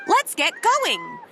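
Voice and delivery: rally the team to start; eager call. Acted. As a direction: penguins cheering Tacky into training. Companions urge Tacky on.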